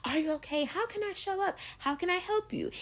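high, soft little caretaker voice; lightly voiced mom friend fussing over everyone; caring mom friend. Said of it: "that role of".

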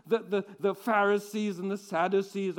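ordinary delivery

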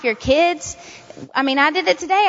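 usual speaking voice

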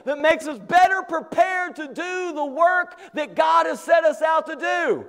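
high, driving pulpit delivery; impassioned, emphatic sermon voice; full-force preaching. Real, not acted. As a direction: preach it hard, pitch held up. Preaches challenge to congregation.

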